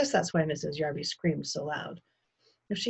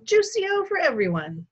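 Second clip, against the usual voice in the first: high, loud cheery announcement; calling out; 12 semitones higher, 6 dB louder than usual. A narrator's reading. Father orders drinks for all, calling out high. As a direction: announce it to the whole room.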